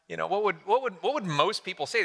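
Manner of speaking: ordinary talk, nothing marked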